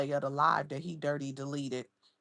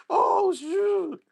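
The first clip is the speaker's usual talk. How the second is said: much higher, louder laugh into oh shoot; shocked burst of laughter; 14 semitones higher, 9 dB louder than usual. Real, not acted. Reacting live to the photo, bursting out laughing. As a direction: crack up in disbelief.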